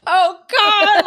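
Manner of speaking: high, loud panicked yelp; panicked cry. Retold or imitated. Two friends in a runaway van, yelping in panic. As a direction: shriek it as the van rolls away.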